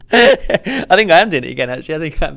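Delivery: high, loud laughing admission. Real, not acted. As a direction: laugh it out, caught being cheeky. Laughing after hoping for MC work.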